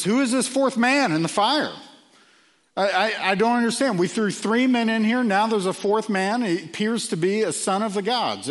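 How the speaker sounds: high, loud cry of disbelief; a stunned king who can't believe his eyes; astonished king. Acted. King astonished at fourth man.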